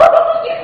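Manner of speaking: sudden high laugh; real laugh bursting out; burst of laughter. Real, not acted. Jokes about the happy dance.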